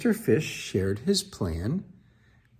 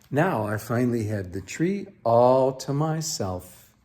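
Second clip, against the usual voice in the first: plain reading voice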